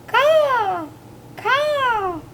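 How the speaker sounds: high, drawn-out crow calls; cawing crows. A narrator's reading. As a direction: caw like a crow. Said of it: "The crows croaked".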